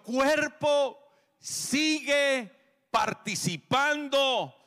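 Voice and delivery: loud, drawn-out preaching over music; preaching at full force. Acted. Pastor proclaiming to the congregation, loud and drawn out. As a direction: proclaim it slowly and loudly to the congregation.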